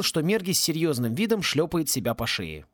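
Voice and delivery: ordinary delivery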